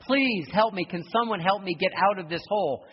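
high, loud pleading cry from a pit; calling up out of a dark hole, desperate; retold yell for help. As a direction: beg to be pulled out. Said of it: "he yells for help".

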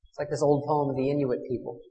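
normal talking voice

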